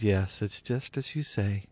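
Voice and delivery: quiet reply. A narrator's reading. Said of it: "And Jesus replied".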